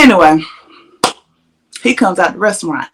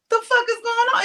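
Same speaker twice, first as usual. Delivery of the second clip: pitched way up in disbelief; baffled; 13 semitones higher, 7 dB quieter than usual. Retold or imitated. She sees the car full of men, higher baffled disbelief. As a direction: squeak it out, not believing your eyes.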